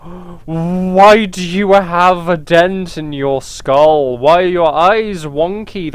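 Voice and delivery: drawn out, slow questions. Real, not acted. Player slowly questioning the odd character's face. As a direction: take your time over each word.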